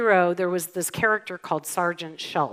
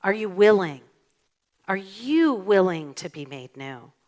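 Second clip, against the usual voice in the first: usual speaking voice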